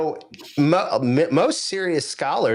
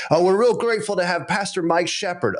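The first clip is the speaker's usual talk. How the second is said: TV host; 6 semitones higher than usual. Retold or imitated. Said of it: "Fox and Friends".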